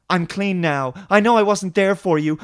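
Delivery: lightly voiced soap-opera mother; hint of a mother pleading with her son. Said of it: "A mother who wasn't there for her son".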